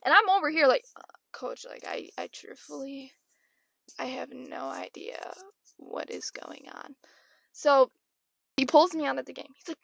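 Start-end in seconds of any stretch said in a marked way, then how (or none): 1.0-7.0 hushed, nearly whispered admission; meek confession. Retold or imitated. Lost player confessing to coach, meek and hushed. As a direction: own up very softly, imitating a lost player.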